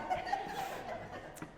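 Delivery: airy squeal of a laugh; breathy high laugh. Real, not acted. Laughing at her own gushing.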